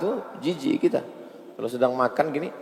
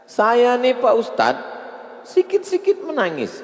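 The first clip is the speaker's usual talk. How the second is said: mock tearful congregant; 11 semitones higher, 3 dB louder than usual. Preacher imitating a congregant confessing his tears, high and pleading. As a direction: whine it out like a weepy worshipper. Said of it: "Ustadz, ya".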